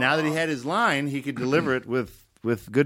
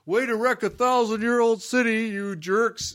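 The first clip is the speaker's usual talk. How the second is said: retold tweet; 9 semitones higher than usual. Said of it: "I said something like".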